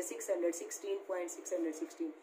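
brisk run-through of the wrong reading, "point six hundred sixteen" read as one number after the point; wrong reading demo. Shown as a demonstration. Teacher shows children the wrong way, reading "point six hundred sixteen" as one whole number. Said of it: "ऐसे नहीं पढ़ेंगे".

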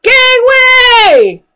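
very high, loud yell. Acted. Little pirates yell to their mommy in a very high, loud yell. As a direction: clear the way at full voice. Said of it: "yell her little pirates".

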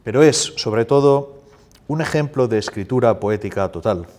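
measured list of phrases, emphatically declaimed; formal reading in Spanish. Acted. Translator reads his Spanish introduction to audience, formal declaimed reading.

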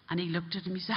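ordinary talk

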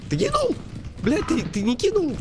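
high frantic yelling at teammate; grenade warning yell. Real, not acted. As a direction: scream for the grenade now. Urging teammate to throw grenade.

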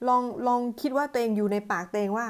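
ordinary talk

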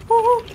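very high, loud woo-hoo; imitated train whistle. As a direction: hoot like an engine whistle. Engine whistle as chase starts.

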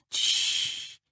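breathy whispered hush; quiet shush. A narrator's reading. Ania hushes the cut boy, whispered. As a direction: hush the hurt boy softly. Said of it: "powiedziała cicho".

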